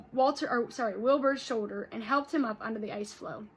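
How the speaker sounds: ordinary delivery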